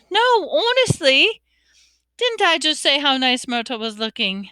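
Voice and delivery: high, protesting fake sincerity; insistent. A narrator's reading. Hermione urging friends to back her up, insistent. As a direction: insist a little too hard.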